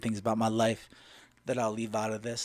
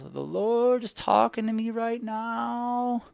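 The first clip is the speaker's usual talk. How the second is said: high, slow, drawn-out old woman impression; entranced old lady; 11 semitones higher, 4 dB louder, much slower than usual. Gym lady announces God is talking, high hammed-up trance. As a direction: stretch the vowels in a mock trance. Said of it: "she's shaking and doing all this weird stuff".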